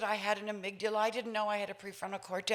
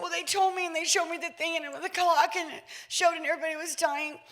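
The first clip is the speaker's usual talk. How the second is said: put-on anxious child; 5 semitones higher, faster than usual. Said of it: "I said".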